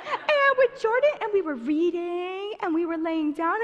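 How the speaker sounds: high, loud put-on kid voice, easing down towards the end; hammed-up tattling little brother; Gabe. Little brother confessing the roof outing, mock high kid voice. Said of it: "this is how he talks".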